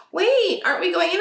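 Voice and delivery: put-on baffled kids in the car; kids surprised. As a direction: kids asking why not go inside. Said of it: "they're like".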